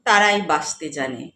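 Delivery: measured recital with lifted line openings and falling ends; poetry recitation. Acted. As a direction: recite the verse with feeling, pausing between phrases. Recites own poem, first of three. (কবিতা আবৃত্তি)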